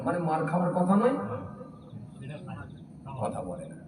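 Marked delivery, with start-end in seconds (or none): 3.2-3.8 dropped low aside. Real, not acted. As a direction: mutter it to the crowd. (নিচু স্বরে মন্তব্য)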